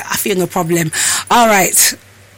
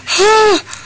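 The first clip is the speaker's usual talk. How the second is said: big high puff of air; out-of-breath sigh; 12 semitones higher than usual. Real, not acted. Host winded from opening the door, sighing hard. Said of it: "God, I'm out of breath".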